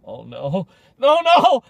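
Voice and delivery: high, loud hammed-up alarm; mock flustered cop. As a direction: pretend shock, slowing at the name. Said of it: "They're probably like".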